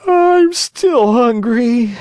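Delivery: very high, slow, drawn-out puppet wail. Acted. Pinocchio has devoured three pears, high drawn-out wail. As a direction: whine it long, loud and high. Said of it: "he yawned deeply and wailed".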